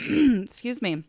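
throat-clearing, slightly husky; frog in throat. Real, not acted. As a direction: clear the throat, then speak. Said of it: "I have a frog in my throat".

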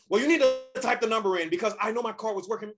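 high, worked-up mock outrage; hammed-up angry insistence, pitched high; losing his mind. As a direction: imitate an irate customer arguing. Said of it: "losing his mind".

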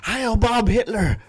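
high, slow, loud parody shout; mock salute shout. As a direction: cry it out in a drawn-out, high character voice.

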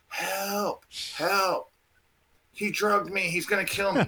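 high-pitched mock yell for help; retold scream. Retelling his panic in the woods to the hosts, mock screaming. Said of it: "start screaming, yelling".

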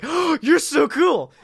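high-pitched swoon after a gasp; starstruck gushing. Retold or imitated. Fan swooning over the bartender who said finish it, starstruck gushing. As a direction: gush like an awestruck fan.

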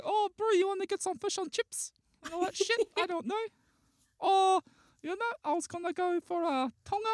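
very high-pitched mock Maori Kiwi voice; squeaky, badly faked New Zealand accent. Shown as a demonstration. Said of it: "I can't do a Kiwi accent".

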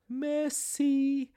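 high, far put-on polite French thanks; prim desk lady. Imitating the polite desk lady to friends, high prim voice. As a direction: chirp it like a prim clerk.

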